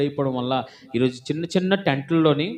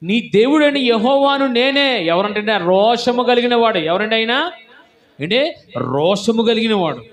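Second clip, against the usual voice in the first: higher and louder, slow hammering proclamation; preaching at full force; 9 semitones higher, 6 dB louder than usual. Acted. Preacher drills the congregation on God's jealousy, loud and slow. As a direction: pound home who God is.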